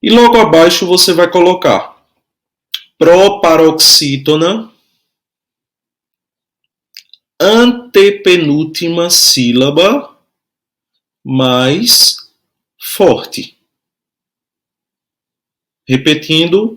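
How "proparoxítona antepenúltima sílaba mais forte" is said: slow, drawn-out phrases with long pauses; measured teacher giving time to write; slow dictation. Shown as a demonstration. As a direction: dictate piece by piece, each rising until the final fall.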